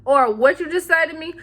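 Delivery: acted feisty offense; mock confrontational. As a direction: snap it as if ready to argue. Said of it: "It just really depends".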